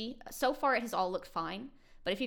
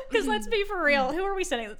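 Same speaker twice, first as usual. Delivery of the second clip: laughing; 13 semitones higher, 6 dB louder than usual. Real, not acted. Host joking nobody listens, laughing hard. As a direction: squeeze it out through laughter.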